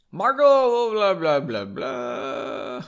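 "blah" babbled past the text; mock skimming. Reader skips ahead with a mocking "blah". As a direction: skim it with a careless "blah, blah". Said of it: "where was I?".

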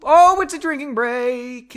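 loud, high cry of delight; excited announcement. Real, not acted. Host announcing the break to listeners, loud and high. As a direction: announce the drinking break with glee.

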